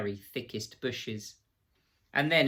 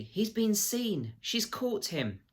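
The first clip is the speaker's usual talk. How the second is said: higher, slow, frightened inner voice; fearful thought; 10 semitones higher, slower than usual. A narrator's reading. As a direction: dread creeping in as she realises. Lucy fears Beaver is caught.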